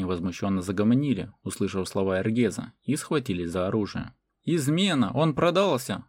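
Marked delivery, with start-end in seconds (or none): 4.4-6.1 high-pitched, restrained shout; higher voice, a touch of outcry; outraged cry. A narrator's reading. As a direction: cry treason in mild narrated alarm. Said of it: "закричал Мартан".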